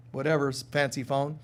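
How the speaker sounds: ordinary talk, nothing marked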